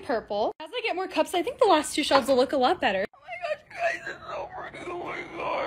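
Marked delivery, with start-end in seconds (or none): 3.1-5.7 high, soft squeal breaking into breath; overcome squeal. Real, not acted. Creator overcome by her pretty shelves, breathy breaking squeal. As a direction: squeak it out till the voice gives way. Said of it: "I don't know what that was".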